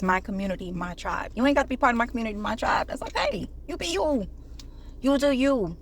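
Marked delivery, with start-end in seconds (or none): 2.9-5.8 breezy dismissive. Acted. Mock dismissive permission.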